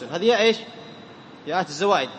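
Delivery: ordinary talk, nothing marked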